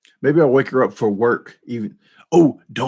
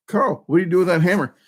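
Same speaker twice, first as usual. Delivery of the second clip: pitched-up quick movie quote; higher, fast quoted questioner; 7 semitones higher, faster than usual. Retold or imitated. Vlogger replaying the hammer scene, high and quick. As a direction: rattle it off high. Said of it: "He said".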